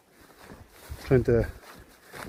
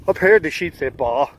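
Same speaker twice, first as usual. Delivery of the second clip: Northern Ireland accent; 3 dB louder than usual. Shown as a demonstration. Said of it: "in a Northern Ireland accent".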